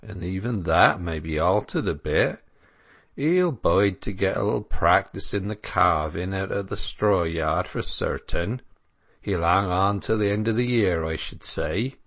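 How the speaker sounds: easygoing rustic voice with country vowels; unconcerned dairyman. Acted. Dairyman guessing how long Clare will stay, unconcerned country voice. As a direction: reckon it out idly like a country dairyman. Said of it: "replied Crick with the same intolerable unconcern".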